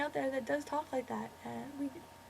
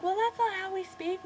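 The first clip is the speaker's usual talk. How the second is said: mimicking offended people, higher-pitched; mock-indignant protest in a raised pitch; ticked off; 7 semitones higher than usual. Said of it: "people get pretty ticked off, and they're like".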